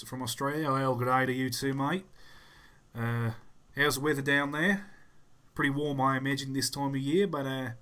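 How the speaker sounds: playful mock-Australian voice; Australian accent. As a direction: greet them like a chirpy Australian. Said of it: "I just like doing voices and accents".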